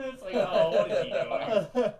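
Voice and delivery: high, loud, slow disbelief; laughing at the absurdity; incredulous laugh. Real, not acted.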